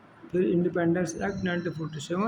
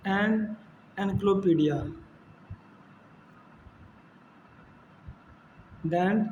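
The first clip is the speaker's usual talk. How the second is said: drawn-out, word-by-word reading of a list; slow syllabus read-out; much slower than usual. Acted. Teacher reading the English syllabus to students, slow and deliberate. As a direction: read each item out slowly, like dictation.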